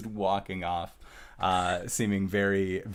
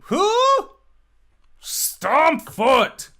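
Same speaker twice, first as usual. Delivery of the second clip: very high, loud shout from a far room; distant shouted reply; 11 dB louder, much slower than usual. Acted. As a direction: yell back from upstairs, much higher and louder. Tifa shouts from upstairs.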